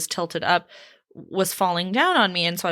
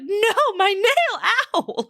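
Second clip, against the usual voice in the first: high, mock-anguished wail; hammed-up distress, high pitched; pained cry; 13 semitones higher, 3 dB louder, much slower than usual. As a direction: replay a dramatic yelp of pain. Retelling her pained outburst over nail.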